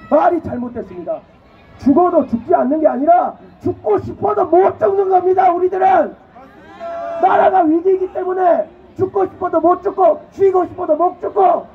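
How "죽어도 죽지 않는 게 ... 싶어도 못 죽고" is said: fiery street speech yelling; rally shout. Real, not acted. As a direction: rouse the crowd at full voice.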